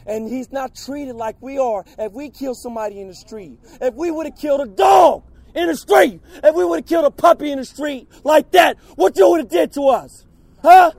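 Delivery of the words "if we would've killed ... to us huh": furious shouting. Real, not acted. Outraged protester at hearing.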